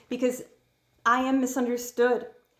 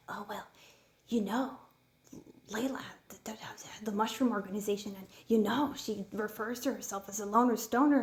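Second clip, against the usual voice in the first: hushed gossip voice; 5 dB quieter than usual. Retold or imitated. Mocking what people would say.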